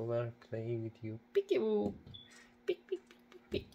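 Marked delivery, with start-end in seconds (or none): 1.3-3.7 put-on squeaky high baby-talk peekaboo; playful high-pitched peekaboo call. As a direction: sing it up high like a game with a baby. Playing peekaboo again.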